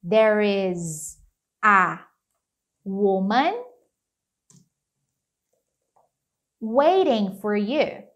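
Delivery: drawn-out words with long pauses; slow English demo sentence; slow teacher model. Shown as a demonstration. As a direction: spell it out slowly for a learner. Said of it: "Vai ficar assim, ó".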